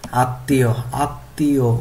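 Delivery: আত্মীয় split carefully as at-ti-yo; pronunciation drill. Shown as a demonstration. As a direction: model আত্মীয় twice for students. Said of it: "উচ্চারণ করতে হবে এইভাবে".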